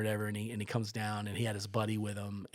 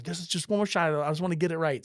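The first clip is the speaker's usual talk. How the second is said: raised, louder mimicked reply; imitated insistent plea; 7 semitones higher, 6 dB louder than usual. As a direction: plead for one last try. Friend pleads for another try.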